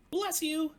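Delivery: very high, loud little cub voice; bright high cub piping up. Acted. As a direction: squeak it out like a tiny eager cub. Little Cub speaks to Old Bear.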